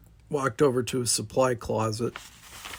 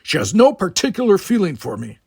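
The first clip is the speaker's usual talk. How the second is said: gruff Klingon, higher and louder, set apart from the narration; a proud Klingon brushing the talk aside; Worf's voice; 7 semitones higher, 8 dB louder than usual. A narrator's reading. Worf denies interest.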